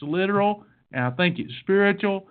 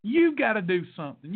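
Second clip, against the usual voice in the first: usual speaking voice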